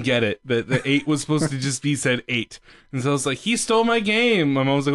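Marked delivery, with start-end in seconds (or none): 3.4-4.5 put-on upset kid. Child complaining to mom.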